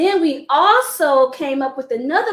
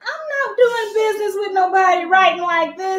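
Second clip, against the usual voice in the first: put-on indignant old man; 6 semitones higher than usual. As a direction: stretch it out like an offended customer. Angry man voiced in story.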